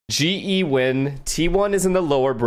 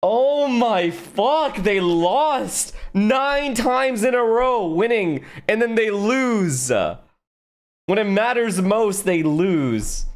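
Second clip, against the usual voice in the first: higher, slow, drawn-out exasperation; frustrated disbelief; 7 semitones higher, much slower than usual. Real, not acted. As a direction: stretch every word out in disbelief at the loss. Streamer reacts to team losing.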